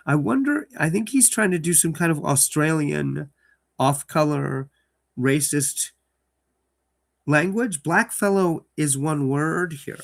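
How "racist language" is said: plain reading voice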